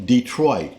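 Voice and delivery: Detroit as dee-troyt; American pronunciation. Shown as a demonstration. How we've always pronounced it, American Detroit, dee-troyt. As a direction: say Detroit with a hard final T. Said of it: "we've always pronounced it".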